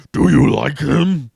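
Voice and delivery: loud, slow booming question. Acted. Conrox questioning the hero, loud booming. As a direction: boom it out like a big brute. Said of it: "he asked with a booming voice".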